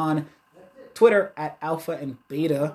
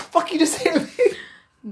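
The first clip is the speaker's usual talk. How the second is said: high, loud, slow outraged squawk; mock outrage; 14 semitones higher, 5 dB louder, slower than usual. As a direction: drag out a hammed-up offended yell. Mocking outraged reaction, laughing.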